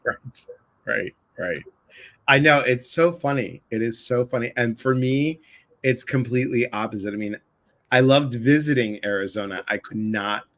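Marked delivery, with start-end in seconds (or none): none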